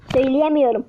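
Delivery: ordinary delivery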